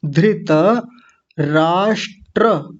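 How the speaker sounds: धृतराष्ट्र stretched very slowly, syllable by syllable; slow demo. Shown as a demonstration. Teacher modelling the word slowly for students. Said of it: "ऐसे।".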